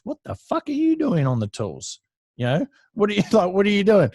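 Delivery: high, loud incredulous boss, half laughing; mock outrage. Boss catching the apprentice on the tools, high incredulous outburst.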